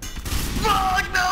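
high-pitched outburst; shouted refusal. Real, not acted. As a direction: cry out in sheer refusal.

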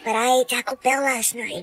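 whispering. Acted. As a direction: keep it down so dad can't hear. Whispered exchange near dad.

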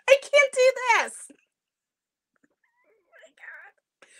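high, giggling through frustration with the mirror; nervous laughter. Real, not acted. Host giggling at her backwards mirror, laughing helplessly. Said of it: "you gotta laugh".

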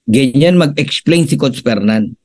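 usual speaking voice

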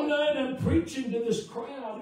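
high, very loud revival preaching; full-force preaching. Real, not acted. Preacher tells congregation of revival and baptisms, high full-force preaching. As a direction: build to a loud, exultant pulpit shout.

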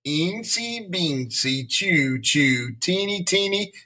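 chug off slowly, then accelerate and rise; slow, picking up speed. A narrator's reading. Principal with eyes closed chanting nonsense, starting slowly then speeding up. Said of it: "started slowly, but picked up speed".

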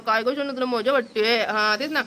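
ordinary talk

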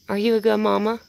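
sing-song pet talk; cooing question. Real, not acted. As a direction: coax the pet with a bright question. Coaxing raccoon, baby talk.

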